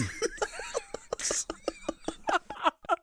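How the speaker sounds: high burst of laughter; big laugh. Real, not acted. Laughing at Mister T story.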